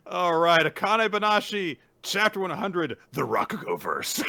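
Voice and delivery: loud, high announcer delivery; hyped-up, grand introduction; title announcement. Acted. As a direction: belt out the title like a show opener.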